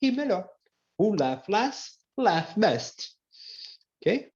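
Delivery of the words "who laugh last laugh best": "laugh" as "laf", slower. Shown as a demonstration. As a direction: say "Who laugh last, laugh best" slowly with F in laugh. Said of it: "Quem ri por último". ("laugh" com som de F, devagar)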